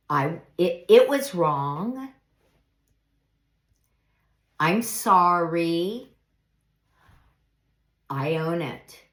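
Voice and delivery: slow, sincere owning up; sincere apology. Shown as a demonstration. Demonstrating the mature apology, slow and sincere. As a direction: admit it gently and slowly. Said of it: "the mature path sounds like this".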